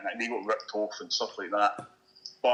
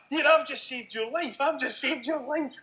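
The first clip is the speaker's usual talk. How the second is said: much higher and slow, a proud boast; proud taxi driver; slower than usual. Retold or imitated. Cabbie boasting to the fan he rescued, high slow proud exclamation. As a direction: pitch it way up and drag it out like a cabbie who's pleased with himself. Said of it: "he's like".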